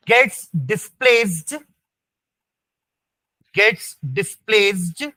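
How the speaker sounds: very slow, phrases repeated for note-takers; teacher's drawn-out dictation voice. Real, not acted. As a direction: dictate word by word, leaving gaps. Dictating notes to students.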